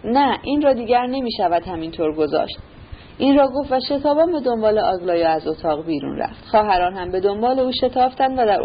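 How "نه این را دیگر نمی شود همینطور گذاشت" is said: ordinary delivery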